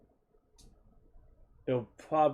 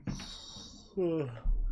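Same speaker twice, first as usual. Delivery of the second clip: long sigh; 5 semitones higher than usual. Real, not acted. Stuck on the problem.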